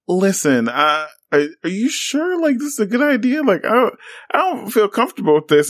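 high, fast anxious mock pleading; hammed-up high worried girls; worried contestants. Contestants confront Taj, acted quote.

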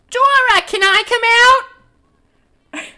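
very high, loud squeaky call; drawn-out high-pitched holler; Marie calling out. Acted. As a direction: call through a door in a shrieky falsetto. Marie calls through the door.

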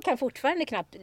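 ordinary talk, nothing marked

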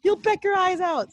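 high, loud mock-panicked mother; mom's panicked warning. Mom scolding then warning her daughter, high mock panic. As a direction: squeal a frantic mom's warning. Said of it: "she's like".

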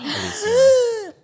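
high squealing name; excited squeal. Real, not acted.

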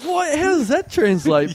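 high startled what, then slow puzzlement; baffled. Retold or imitated. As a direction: sound totally thrown. Baffled reaction to taxi insult.

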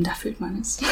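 breathy conspiratorial whisper; whispered aside. Real, not acted. Telling her friend about dating, whispered. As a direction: drop to a secret whisper.

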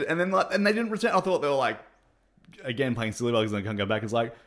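normal talking voice

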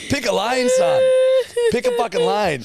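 high, loud exasperated shout; exasperated yell. Real, not acted. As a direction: throw your hands up and yell. Exasperated rant about a fickle guy.

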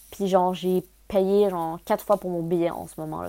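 normal talking voice

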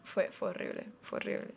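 hushed whisper of shame. Real, not acted. Admitting to viewers she forgot mom's birthday, whispered. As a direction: whisper it like a guilty confession.

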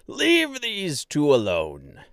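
slow, hearty mayor scolding kindly; jovial boom. Acted. Mayor shoos children away from guests, jovial boom. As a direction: boom it out good-naturedly. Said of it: "A loud, jovial voice boomed".

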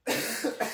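sharp dry cough from an irritated throat. Real, not acted. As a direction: cough hard with allergy throat. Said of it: "Nashville dry throat allergy season".

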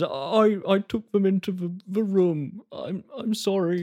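high, halting, tearful bear voice; meek, high, mock-tearful apology; sobbing bear. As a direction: stammer a confession as a sorry stuffed bear. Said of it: "it seems to sob".

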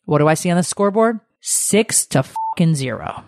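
ordinary talk, nothing marked

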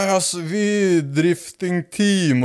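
slow, stretched-out mock drunk reply; drawn-out drunk boast. Drunk man bluffs to car kids, slow drawn-out boast. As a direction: drag every word out tipsily.

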